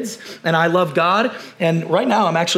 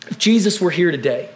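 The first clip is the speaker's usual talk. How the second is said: usual speaking voice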